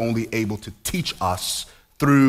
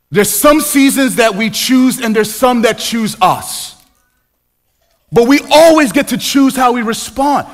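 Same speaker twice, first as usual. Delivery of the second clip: higher and louder, a near-sung preacher's cadence; impassioned preaching; 11 semitones higher, 8 dB louder than usual. Acted. Pastor presses the congregation with rising fervent preaching. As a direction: build it up like a pulpit call.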